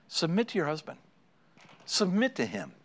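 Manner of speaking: soft, gentle instruction; Jesus. Acted. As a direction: quietly urge it, with a pause between. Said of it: "When Jesus says to you ladies".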